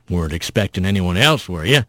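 put-on low, soft old armadillo voice; an old-timer's low, easy teasing question. A narrator's reading. Old armadillo teasing Rat by the fire, low old voice.

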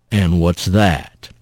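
low, slow rumble; challenging rough voice. Acted. Mysterious voice challenges defiant Rat, low and slow. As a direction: ask it slowly, deep down low.

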